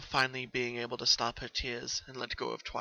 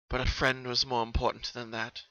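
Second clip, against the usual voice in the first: ordinary delivery, nothing marked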